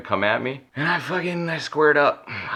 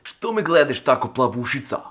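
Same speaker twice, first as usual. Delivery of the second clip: high, mocking foreign-guy voice; a mocking, high Serbian put-on; imitating the Serbian guy; 7 semitones higher than usual. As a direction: sneer it up high like the guy on the bus. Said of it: "Which basically means".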